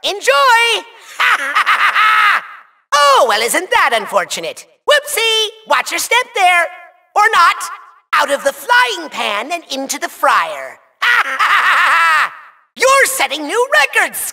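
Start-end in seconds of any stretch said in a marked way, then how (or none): 1.2-2.4 short villainous cackle; evil laugh. Acted. Villain unleashes a stronger clone, evil cackle. 11.0-12.3 gloating villainous cackle; evil laugh. Acted. Villain laughs at his pun, evil cackle.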